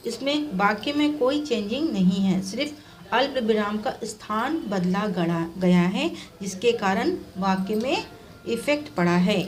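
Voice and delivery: usual speaking voice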